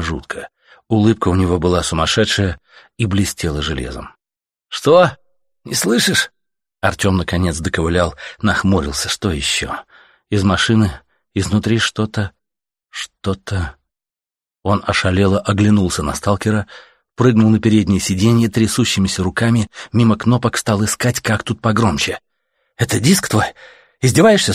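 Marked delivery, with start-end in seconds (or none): 4.7-6.3 very high, insistent question; raised questioning voice. A narrator's reading. Stalker checking if Artyom hears, raised questioning voice. Shouting to a half-deaf man. 22.8-24.5 high, disbelieving challenge; incredulous questioning. A narrator's reading. Artyom doubting the music is real, incredulous questioning.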